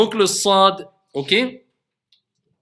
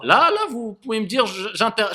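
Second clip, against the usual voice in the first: ordinary talk, nothing marked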